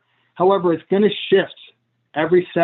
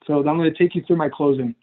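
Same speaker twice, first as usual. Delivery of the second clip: ordinary talk, nothing marked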